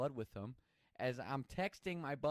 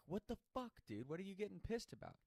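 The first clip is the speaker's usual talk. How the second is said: angry; 8 semitones higher, 4 dB quieter than usual. Retold or imitated. Arguing back at angry friend.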